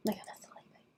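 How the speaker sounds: whispered, drowsy repeat; sleepy whisper. Real, not acted. Streamer tells chat how sleepy she is, whispering. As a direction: breathe it out half asleep. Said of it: "so sleepy".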